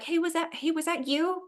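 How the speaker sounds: mock call to a driver, asking; questioning call. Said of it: "That's why we're like".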